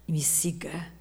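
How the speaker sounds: a soft whispered invitation. Retold or imitated. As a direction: breathe it like a secret. Fala de Deus sussurrada. (Deus sussurrando o convite)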